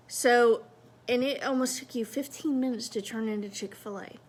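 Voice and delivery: ordinary talk, nothing marked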